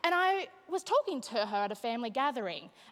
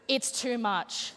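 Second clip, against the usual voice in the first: ordinary delivery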